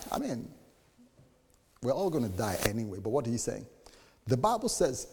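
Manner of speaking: ordinary delivery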